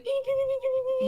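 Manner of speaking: high, bright ding ding bird call; imitating a plover call. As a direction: chirp ding like a little plover. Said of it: "they're going like".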